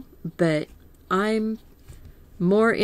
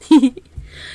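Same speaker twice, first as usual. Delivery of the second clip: a short real chuckle at her own joke; light laugh; 7 semitones higher, 9 dB louder than usual. Real, not acted. Painter laughs at her own nap joke to viewers, light chuckle.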